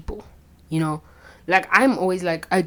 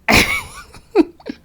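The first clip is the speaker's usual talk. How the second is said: high giggle; 8 dB louder than usual. Real, not acted. Telling viewers about a crush, a high giggle. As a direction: burst of squeaky high-pitched laughter. Laughing at her own story.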